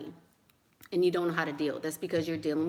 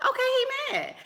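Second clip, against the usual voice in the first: mock shock. Real, not acted. Mocking a sugar daddy's anger.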